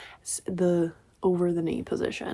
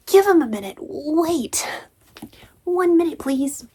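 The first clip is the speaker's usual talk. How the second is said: higher, louder snappish voice; mock snapping subconscious; 8 semitones higher, 4 dB louder than usual. Reader voicing the snappy subconscious, higher and louder. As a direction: bark it out like a bossy inner voice. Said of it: "My subconscious snaps".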